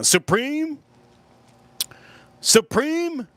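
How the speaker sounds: high-pitched eager voice listing pizzas; high excited pizza list. Retold or imitated. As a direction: squeak out the choices, high and eager. Retold excited pizza options.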